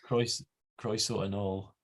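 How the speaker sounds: "Croeso anal" slow and soft, trying Welsh "croeso yn ôl"; careful Welsh attempt. Shown as a demonstration. Host practising welcome back after the guest's model, careful Welsh attempt. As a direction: slowly copy "Croeso anal" as Welsh, restarting the first word. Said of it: "Yeah, I was good".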